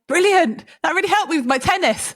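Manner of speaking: high, loud mock-delighted reaction. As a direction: exclaim it brightly, high and playful, as a retold reaction. Delighted reaction in a retold story.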